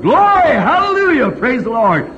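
high, loud imitated hollering of the beer-drinking saints; hollering praise. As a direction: holler it out high like a rowdy crowd.

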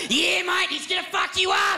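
yelling Australian sidekick; dumb Aussie sidekick. Acted. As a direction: cheer the bully on like a dim mate. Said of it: "dumb best friend that's like".

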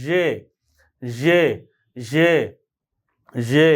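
যে buzzed zh, not Bangla j; zhe sound demo. Shown as a demonstration. Teacher demoing the zh sound for students, drawn-out buzz. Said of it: "আমার সাউন্ডটা শোনেন".

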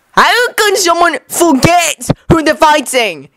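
high, incredulous demand. Acted. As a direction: demand it slowly, in disbelief. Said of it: "Kiba demanded".

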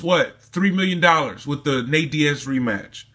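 ordinary delivery

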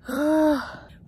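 big exhausted sigh at the top; loud relieved sigh. Real, not acted. Reaching the top of the hike.

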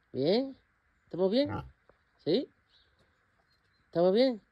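high, sing-song questions to an animal; pet talk. Real, not acted. Talking sweetly to her animals. (voz aguda hablando a un animal)

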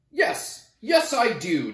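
high, eager yes; excitedly. Acted. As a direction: jump at the idea. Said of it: "Nebraska said excitedly".

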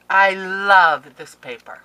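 loud, slow, stretched "love"; adoring outburst. Real, not acted. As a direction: declare it with huge adoration. Gushing over the gifted paper.

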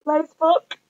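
"Lace book" rising high in delight; excited realisation. Real, not acted. Realising the gift is her lace book, thrilled. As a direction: squeal it as it dawns on you. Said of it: "I'm like, okay".

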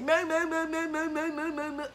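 repeated high "Mo" in a mock voice; mocking imitation. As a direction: parody people overusing Mo. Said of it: "I hate when people be like".

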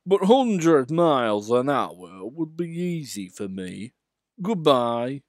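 mock-modest Gordon. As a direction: boast while claiming not to. Gordon boasting.

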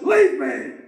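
high, cold banishing cry; the king sends the goats away with a high dismissal; king's dismissal. Acted. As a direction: cast them out as the king. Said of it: "He says".

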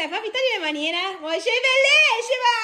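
high, loud gushing praise. Real, not acted. Mother gushing over her daughter's new outfit, high and loud. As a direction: rave over how she looks.

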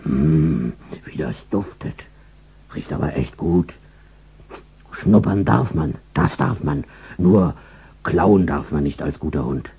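low, soft, tempted murmur; greedy dog near the cookies, hushed; dog murmuring to himself. Acted. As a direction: sniff and reason yourself out of it. Dog talks to itself sniffing.

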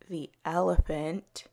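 ordinary delivery

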